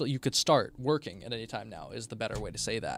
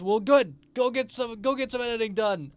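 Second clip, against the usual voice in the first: cheery send-off; 9 semitones higher, 4 dB louder than usual. Real, not acted. Cheery farewell to a viewer.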